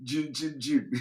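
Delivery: soft imitation of a man's voice; quiet, lightly voiced mimicry; Traditional Wokedi. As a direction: lightly mimic his voice in hushed syllables. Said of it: "his voice is like".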